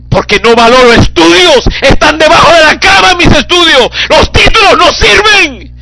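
loud, high, impassioned sermon cry; full-force preaching. Acted. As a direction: preach it out with fire. (predicación apasionada a gritos)

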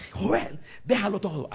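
loud, high command, acted out; retold shout. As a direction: imitate a voice shouting someone awake.

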